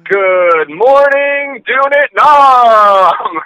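drawn-out, loud radio DJ wake-up call; Robin Williams radio greeting. Acted. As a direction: stretch every word like a morning DJ. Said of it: "I never saw that Robin Williams movie".

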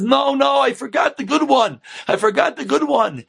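acted shout of a frantic father; flustered shouting. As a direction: insist urgently up to the window.

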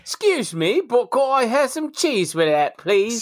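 mock-polite British accent, high and slow; a silly British accent, slow and high; bad British accent. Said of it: "didn't you get a bad British accent".

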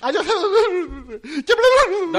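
loud, high mock tantrum; hysterical wife parody. Wife threatening husband in a staged row, mock hysterics. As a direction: wail it in hammed-up hysterics. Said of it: "Εγώ, η γυναίκα".

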